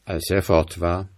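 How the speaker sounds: ordinary delivery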